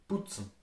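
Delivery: putzen softly, P close to B; Austrian pronunciation. Shown as a demonstration. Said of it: "they are more pronounced softly".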